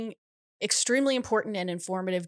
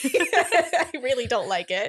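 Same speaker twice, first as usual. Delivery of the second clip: laughing; 5 semitones higher, 5 dB louder, slower than usual. Real, not acted. Laughing about hated technique.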